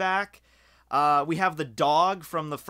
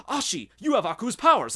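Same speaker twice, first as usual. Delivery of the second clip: a touch higher, louder discovery; mock surprised Jack; 6 semitones higher, 4 dB louder than usual. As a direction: replay the realization. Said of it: "let, like".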